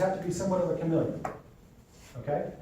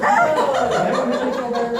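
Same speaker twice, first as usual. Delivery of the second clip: laughing; 8 semitones higher, 10 dB louder, much slower than usual. Real, not acted. Laughing retort in role-play.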